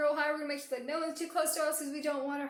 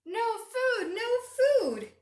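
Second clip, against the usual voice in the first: high, loud storybook exclamation; animated high wail for small listeners; dismayed cry; 13 semitones higher, 11 dB louder, much slower than usual. A narrator's reading. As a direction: cry out in alarm at finding nothing. Hungry chameleon finds nothing.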